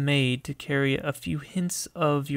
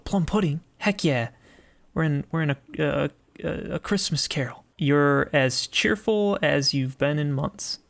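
usual speaking voice

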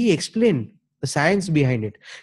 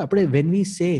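ordinary talk, nothing marked

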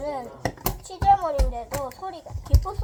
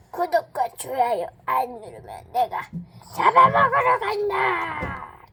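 playful monster threat; 6 dB louder than usual. Pretend.

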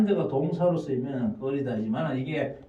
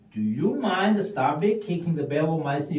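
slow, clear English model sentence; slow drill; 4 semitones higher, much slower than usual. Shown as a demonstration. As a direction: say each word of the request slowly and clearly. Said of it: "미국 사람들 보면은". (천천히 또박또박 읽는 예문)

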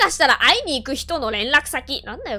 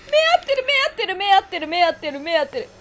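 frightened; 7 semitones higher, 3 dB louder than usual. Real, not acted. Scared, fading repetition.

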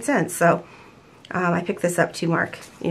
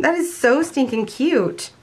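gushing; 7 semitones higher, slower than usual. Real, not acted. Gushing over a sticker kit.

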